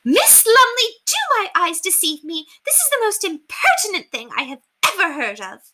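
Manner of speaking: very high, loud outrage; let loose. Acted. Outraged lady lets loose at the governess. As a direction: explode at the governess. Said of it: "she let loose".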